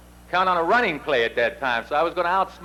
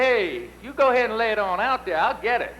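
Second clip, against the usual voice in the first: higher-pitched imitation of a confident teammate; a light put-on Texan voice, pitched up; imitating Don; 8 semitones higher than usual. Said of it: "That's the way he talks".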